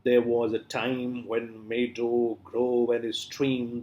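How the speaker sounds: measured recital of a line; slow poem recitation. Shown as a demonstration. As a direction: read it out like a poem. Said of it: "this is the line".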